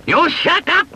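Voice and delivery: shrill witch screech. A narrator's reading. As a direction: screech it at him. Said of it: "screeched Fenella".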